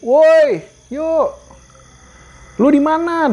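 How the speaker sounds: high shouted reply from far off; distant friend calling back. Retold or imitated. Friend answering the lost hiker from afar, high call. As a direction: imitate a friend yelling back through the trees. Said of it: "Baru kedenger".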